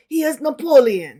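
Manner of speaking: a quick taste of French; French flavor. Shown as a demonstration. Showing listener the Napoleon voice, French-flavored. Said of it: "give the flavor of".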